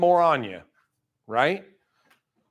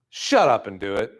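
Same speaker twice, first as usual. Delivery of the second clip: ordinary talk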